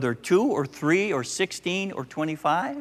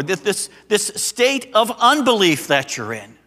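much higher and louder impassioned plea; preaching as Jesus; 10 semitones higher, 7 dB louder than usual. Acted. Preacher speaking as Jesus to an unbelieving generation, impassioned and loud. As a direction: cry out as a weary, pleading Jesus.

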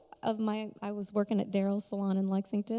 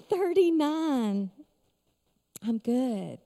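ordinary talk

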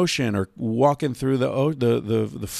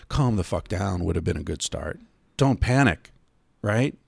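ordinary talk

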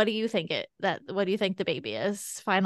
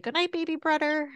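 put-on higher, sweet child's goodnight; little girl voice; 8 semitones higher than usual. Mother imitating her daughter kissing her belly, high child voice.

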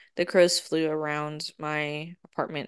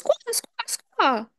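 three squawks, much higher; high crow caws. Retold or imitated. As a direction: shriek them out like an angry crow. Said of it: "And the crow goes".

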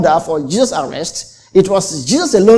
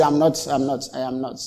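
I'm not, I'm not, I am not, trailing off softer and lower; a cornered man's denial sinking to almost nothing; fading denial; 7 semitones lower, 9 dB quieter than usual. Retold or imitated. Peter boxed in the corner, denying in a fading voice.

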